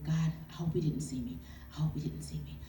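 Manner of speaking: breathless whispered plea to God; whispered prayer. Retold or imitated. Praying at the gas station, a hushed whisper. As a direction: whisper the prayer, hiding at the pump.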